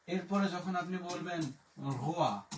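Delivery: normal talking voice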